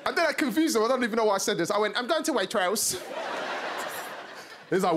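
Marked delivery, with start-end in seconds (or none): 2.0-3.0 high, slow posh mock voice. Scared man confusing a rude boy, high posh lady voice. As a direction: plead like a scared middle-class lady.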